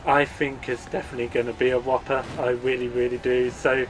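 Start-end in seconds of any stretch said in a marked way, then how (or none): none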